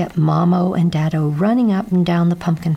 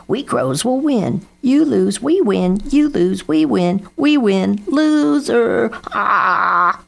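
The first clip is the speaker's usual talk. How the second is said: gloating sing-out, higher in pitch; jeering crow; 4 semitones higher, 3 dB louder, slower than usual. A narrator's reading. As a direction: taunt them like a crow sure of winning. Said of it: "jeered Grandfather Crow".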